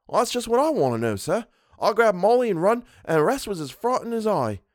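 high-pitched, puzzled Cockney servant; Cockney dialect. A narrator's reading. Servant puzzling over his own flight, in Cockney dialect. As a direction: protest it as a baffled Cockney. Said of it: "Thomas's Cockney dialect".

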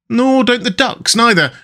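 reply brightly as the rat, pitched well up; perky storybook comeback; cheerful. A narrator's reading. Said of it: "replied the rat cheerfully".

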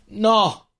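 sudden high, loud refusal; frightened shout. A narrator's reading. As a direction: shout it while pulling away. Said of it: "urlò lei scansandosi". (urlo acuto di rifiuto)

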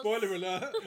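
high, laughing warning; mock alarm. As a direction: interrupt with a playful protest. Mock protest at plot spoiler.

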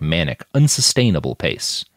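usual speaking voice

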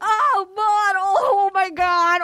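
high, hammed-up happy wailing; hysterical joy. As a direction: sob and shriek like a jackpot winner. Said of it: "her reaction, if someone had walked in with a big check".

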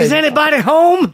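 loud, high mock holler; joking call-out. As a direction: call out as if into a house. Jokingly calling out after visitor interruption.

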